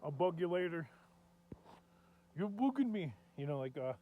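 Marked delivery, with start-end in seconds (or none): 2.4-3.1 higher-pitched attempted Irish accent; amateur Irish accent impression. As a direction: try a rough Irish lilt, swooping up on "bugging". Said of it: "[name] from YouTube".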